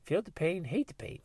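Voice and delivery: put-on high toddler voice, cute and earnest; two-year-old voice. Two-year-old correcting the family motto, high toddler voice. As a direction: lightly play a stubborn two-year-old. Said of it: "She's like".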